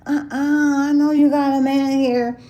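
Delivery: loud, high mock yell; imitated screaming. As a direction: imitate a pushy guy hollering at you. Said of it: "the guy screaming at me".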